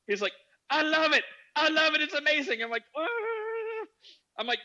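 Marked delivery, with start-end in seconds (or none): 0.7-2.6 very high, loud raving; gushing delight. Retold or imitated. Acting friend's gleeful reaction. 3.0-3.9 long, very high "ah"; horrified wail. Retold or imitated. Acting own horrified reaction.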